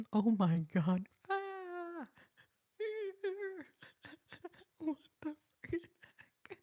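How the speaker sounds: high, breathless laughing exclamations; cracking up. Real, not acted. Streamer reacting to a hilarious chat moment, cracking up. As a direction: gasp it out through helpless laughter.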